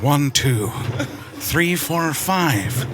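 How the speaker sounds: low, measured Neeson count; slow, grave count with pauses; Liam Neeson cadence. Shown as a demonstration. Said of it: "With Liam Neeson".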